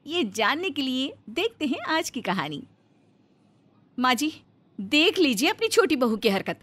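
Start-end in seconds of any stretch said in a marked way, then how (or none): 4.0-6.6 higher, tattling complaint to mother-in-law; a touch louder, stirring up trouble; scheming elder daughter-in-law. Acted. Elder bahu complains to her mother-in-law, higher, tattling.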